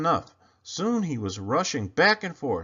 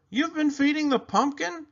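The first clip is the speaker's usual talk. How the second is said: much higher, shocked question; surprised boy Clayton; 4 dB louder than usual. Acted. Clayton discovers Desmond feeding the pumpkin, high and shocked. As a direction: blurt it in high astonishment.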